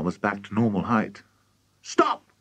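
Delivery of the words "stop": high, sudden cry; high-pitched urgent command; alarmed cry. A narrator's reading. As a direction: cry out high to halt her. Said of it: "cried Missus Cranky".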